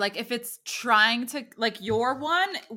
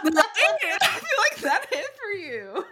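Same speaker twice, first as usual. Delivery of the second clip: high, louder, laughing delight; excited laughing; 11 semitones higher, 5 dB louder than usual. Real, not acted. To her friend after the card's message, laughing excitedly. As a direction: squeal that it landed.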